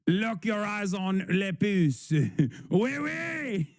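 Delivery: high, slow mock French accent, laughing at the end; hammy Frenchwoman, cracking up; French-accented mock lady, laughing; bad French accent. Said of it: "Somehow your accents get worse with time".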